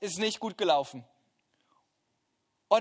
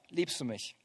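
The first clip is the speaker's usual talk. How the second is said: soft, low, tender asking; Jesus tenderly asking Peter on the beach; gentle question; 6 semitones lower, 8 dB quieter than usual. Acted. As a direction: ask it gently, as a friend. Repeats simple question softly.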